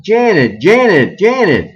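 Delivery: urgent yells across the kitchen; yelling a name. Acted. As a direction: call her name loud and frantic. Beth and Maggie yelling her name.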